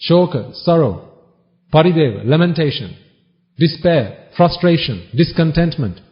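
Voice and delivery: normal talking voice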